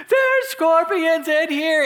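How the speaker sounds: high, stretched-out mock-panic yell; hammed-up hysterical warning; losing their minds. Imitating guys waking everybody over scorpions, hysterical mock yell. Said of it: "the guys are losing their mind".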